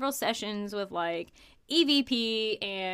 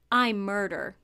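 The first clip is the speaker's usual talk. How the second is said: deep spirit voice; 3 semitones lower than usual. Retold or imitated. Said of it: "Another male voice comes through and says".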